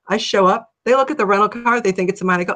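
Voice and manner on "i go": usual speaking voice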